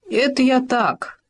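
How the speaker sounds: slow, grumbling brush-off; grumbled. A narrator's reading. Khoma brushing the fox off, a slow grumble. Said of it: "буркнул Хома".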